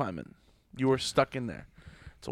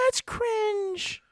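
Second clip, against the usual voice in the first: put-on high, snooty teen voice; mocking teen voice. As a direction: sneer it like a bratty teen. Same mock voice repeated.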